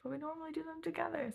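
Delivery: high, whiny, put-upon; sad little friend voice. Retold or imitated. Imitating her sad friend who wants to read together. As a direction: sound like she's been let down. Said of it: "She'd be so sad".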